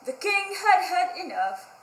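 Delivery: usual speaking voice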